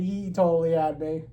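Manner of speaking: usual speaking voice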